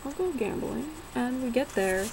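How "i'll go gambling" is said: high, sing-song fake cheer; mock chirpy agreement. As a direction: chirp it like an eager kid. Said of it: "so I was like".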